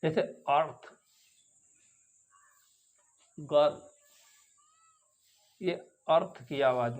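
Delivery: अर्थ and गर्ल with a soft er; er sound. Shown as a demonstration. Teacher showing the er vowel to the class. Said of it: "अर की आवाज़ से मिलती हुई".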